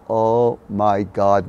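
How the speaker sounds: drawn-out oh my God. Shown as a demonstration. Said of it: "It was like a, moment".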